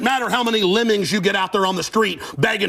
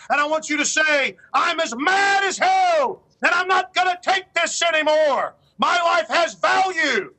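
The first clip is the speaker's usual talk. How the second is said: Howard Beale's rant; 8 semitones higher than usual. Retold or imitated. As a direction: roar it like the anchor who's had enough. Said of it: "As Howard Beale said in Network".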